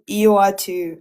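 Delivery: ordinary delivery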